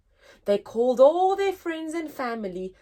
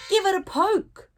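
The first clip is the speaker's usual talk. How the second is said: high, louder cheeky penguin nudge; eager penguin; 8 semitones higher, 5 dB louder, faster than usual. Acted. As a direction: urge the others on, pitched up and bold. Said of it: "said Penguin Prue".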